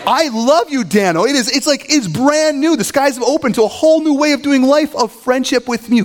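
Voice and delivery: high, over-the-top brotherly declaration; mock heartfelt gushing. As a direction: gush with exaggerated, insincere revelation. Sarcastic forced gushing to brother.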